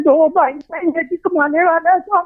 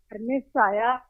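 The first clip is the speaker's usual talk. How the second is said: bad connection; 3 semitones lower, 9 dB quieter than usual. Real, not acted. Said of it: "آپ کی آواز کٹ رہی ہے".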